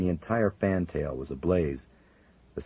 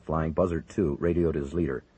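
plain reading voice

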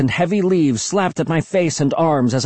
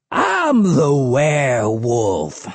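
wild, drawn-out proclamation; crazed hermit; much slower than usual. A narrator's reading. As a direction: draw it out slowly, falling in pitch. Crazed hermit proclaims himself werewolf.

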